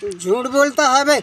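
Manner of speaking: a policeman's rough rebuke, voiced by the victim; retold angry accusation. Victim retelling the policeman calling him a liar, gruff scolding.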